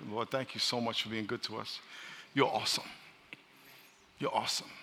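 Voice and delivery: soft, low prayer voice; quiet prayer. Real, not acted. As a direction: pray softly and humbly. Pastor leads a quiet prayer.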